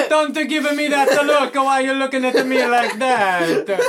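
put-on high, slow, protesting Italian accent; hammed-up Italian with every word drawn out. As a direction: plead loudly in a cartoon Italian accent. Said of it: "an Italian accent".

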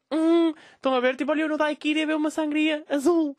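high, preening mock voice; vain selfie poser. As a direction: parody a self-absorbed influencer.

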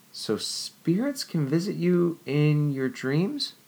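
high, breathless wonder rising; wondering young woman. A narrator's reading. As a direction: pile up the questions in awe. Jennifer wondering.

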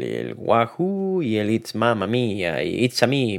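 ordinary delivery

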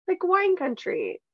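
high slow drawn wine country; lightly mock Morgan's dreamy tone; imitating Morgan. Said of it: "Morgan kept saying".

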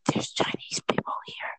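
hushed aside, no voice; whispered wedding aside. Retold or imitated. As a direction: lean in and breathe it like a secret.

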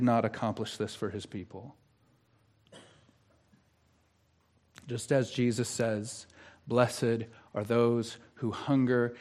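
solemn, quiet assurance from the pulpit; hushed pastoral appeal. Real, not acted. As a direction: gently press the question on the congregation. Tender appeal to the congregation.

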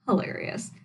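ordinary talk

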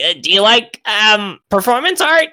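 high, hesitant goblin voice. Acted. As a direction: offer it tentatively as an odd little goblin, rising high at the end. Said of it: "he's like".

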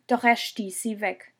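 ordinary delivery

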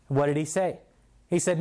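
normal talking voice

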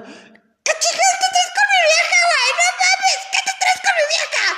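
very high, loud, laughing yell; mock jealous yell. Boyfriend yelling at him about his girl, hammed-up shriek. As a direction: ham up an angry boyfriend.

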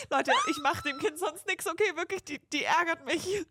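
loud, high, half-laughing protest; mock desperate plea. Embarrassed mother protesting to bystanders, mock desperate. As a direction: plead your innocence to onlookers.

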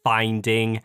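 very slow, each word spelled out for a phone; slow robotic dictation. Retold or imitated. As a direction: dictate it slowly to voice recognition. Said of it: "in a completely different voice".

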